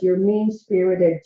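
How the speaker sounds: ordinary talk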